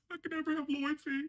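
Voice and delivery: high mock-tearful whine; mocking Porsha. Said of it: "she goes to the bathroom and says".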